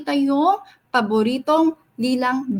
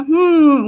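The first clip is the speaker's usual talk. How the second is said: thinking hum; 6 dB louder than usual. Acted. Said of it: "napaisip si Gail, nakaganon siya".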